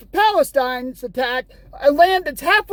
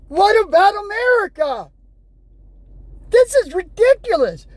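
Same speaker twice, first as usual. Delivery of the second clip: higher, angry yell about America; 6 semitones higher than usual. Real, not acted. Vlogger yelling at the news on screen, outraged. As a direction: let it burst out, outraged. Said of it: "this video is me yelling".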